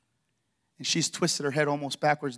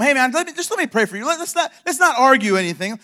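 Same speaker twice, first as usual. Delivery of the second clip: eager plea; 9 semitones higher, 9 dB louder than usual. Retold or imitated. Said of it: "Just tell 'em".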